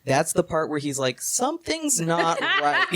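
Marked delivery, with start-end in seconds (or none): 1.2-2.9 high, slow, laughing delivery; mock dawning alarm. Jonathan seeing the Count crawl the wall, mock alarm. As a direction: draw it out high, as if it only now dawns.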